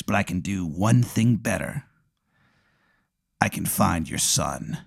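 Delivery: soft pirate, slowing to a low finish; pirate tomato. Acted. Pirate tomato offers to find Aaron's son, hushed and slowing. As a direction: make a quiet pirate's offer, ending slow. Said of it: "he's a pirate".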